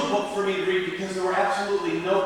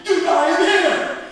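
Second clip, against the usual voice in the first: high, loud dude greeting; loud, high-pitched party-dude voice; goofy dude surgeon; 9 semitones higher, 9 dB louder than usual. Acted. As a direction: greet the patient like an overexcited slacker. Dude surgeon announces himself.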